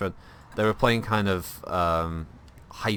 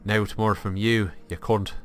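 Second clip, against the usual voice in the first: put-on Irish accent; 2 semitones higher than usual. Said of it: "Where's your, where's your accent from there".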